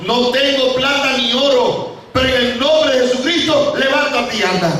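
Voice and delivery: high-pitched proclamation; ringing command. Acted. Peter commands lame man to walk.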